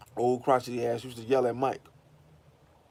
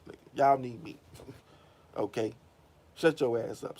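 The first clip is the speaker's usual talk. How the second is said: Durant mouthing off; 3 semitones higher than usual. Retold or imitated. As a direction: put the coach in his place. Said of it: "Durant like".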